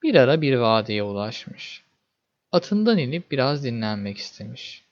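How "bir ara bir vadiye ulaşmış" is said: usual speaking voice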